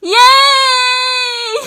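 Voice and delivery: loud high shriek of joy; real joyful scream. Real, not acted. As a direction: scream with real delight at the news. Reading her acceptance to university.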